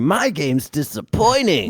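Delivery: high, drawn-out cartoon hero voice; slowed high mascot impression; Sonic. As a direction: whine it in a high put-on game-character voice. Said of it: "his Sonic impression's actually pretty good".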